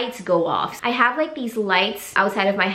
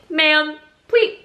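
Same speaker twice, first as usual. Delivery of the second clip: higher, firm plea; stern scolding; 6 semitones higher than usual. Retold or imitated. What she wishes she'd told the neighbor, stern higher voice. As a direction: put your foot down politely. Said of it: "I should have just been like".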